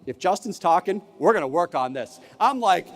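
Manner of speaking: usual speaking voice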